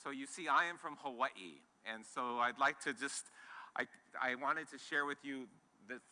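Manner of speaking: ordinary talk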